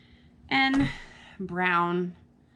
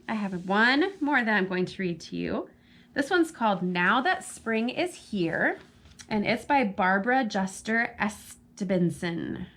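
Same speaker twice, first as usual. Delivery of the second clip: ordinary delivery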